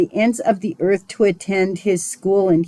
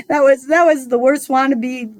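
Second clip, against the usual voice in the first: higher-pitched, tickled by own blunder; laughing self-mockery, pitched up; amused, laughing; 7 semitones higher than usual. Real, not acted. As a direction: say it through laughter at yourself. Laughing at own failed accent.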